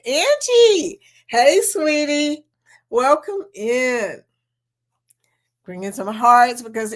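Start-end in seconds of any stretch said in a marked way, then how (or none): 0.0-1.0 drawn out, high and slow welcome; a singing hello to a new member; sing-song greeting. Real, not acted. 1.3-4.2 slow, high sing-song hey; a drawn-out sweetie; sweet greeting. Real, not acted. Greeting chat member.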